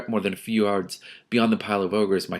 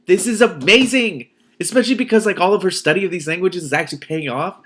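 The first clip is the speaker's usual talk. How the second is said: thrilled exclamation; 9 semitones higher, 7 dB louder than usual. Real, not acted. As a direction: gush with excitement. Reader thrilled by heroine's trick.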